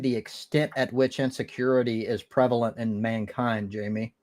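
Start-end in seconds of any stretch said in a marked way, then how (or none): none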